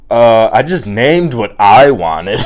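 loud, drawn-out exasperation; exasperated. Acted. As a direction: protest loudly in mild disbelief. Exasperated reply in acted argument.

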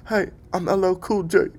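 high, loud, hammed-up celebrity voice. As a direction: badly fake a movie star. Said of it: "I don't even know what [name] sounds like".

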